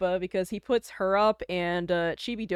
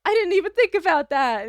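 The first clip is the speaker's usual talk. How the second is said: embarrassed realization; 6 semitones higher, 8 dB louder than usual. Retold or imitated. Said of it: "And I was like".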